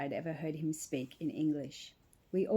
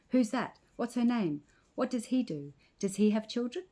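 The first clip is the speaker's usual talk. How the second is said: high, quick-fire curious questions; eager questions; 6 semitones higher than usual. A narrator's reading. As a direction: pepper him with nosy questions. Villagers crowd him with questions.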